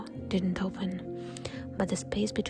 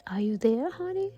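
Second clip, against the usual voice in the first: imitated mother's voice; 3 semitones higher, 5 dB louder than usual. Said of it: "something that didn't know how human speech worked".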